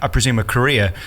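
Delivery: ordinary talk, nothing marked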